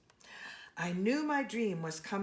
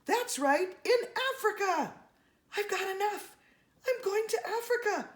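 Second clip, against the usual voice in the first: high, bright rush of joy; excited; 12 semitones higher, 3 dB louder than usual. Acted. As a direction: burst out with thrilled delight. Young Jane counts trip money.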